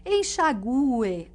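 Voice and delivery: "enxague" drawn out with the stress on the U, en-xa-GU-e. Shown as a demonstration. Teacher showing the tonic U in "enxague", slow exaggerated stress. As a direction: lean slowly on the U of "enxague". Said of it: "Esse U tônico".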